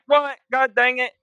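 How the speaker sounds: high, loud frustrated what; frustrated surprise. Retold or imitated. The rival player losing the shot, high exasperated surprise. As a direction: act out the rival's sudden annoyance. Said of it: "He's like".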